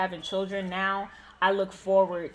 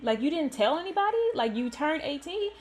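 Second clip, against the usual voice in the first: higher, incredulous questions; imitating surprised friend; 8 semitones higher than usual. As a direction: act out the friend's disbelief. Best friend learns of hidden birthday.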